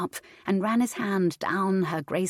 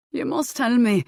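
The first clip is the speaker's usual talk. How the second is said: teasing; 6 semitones higher, 6 dB louder than usual. A narrator's reading. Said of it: "he teased her".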